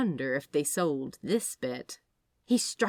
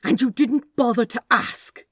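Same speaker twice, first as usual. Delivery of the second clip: loud, higher snarl; 6 semitones higher, 10 dB louder than usual. Acted. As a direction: spit the words out in anger. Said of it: "Aziraphale snarled".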